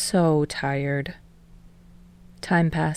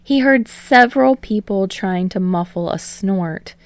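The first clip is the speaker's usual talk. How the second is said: ordinary delivery, nothing marked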